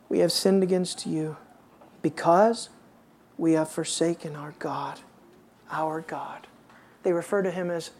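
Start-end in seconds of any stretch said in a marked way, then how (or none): 0.1-6.5 low, soft reverent reading; hushed confession. Acted. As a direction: sink almost to a whisper. Preacher reads confession in hush.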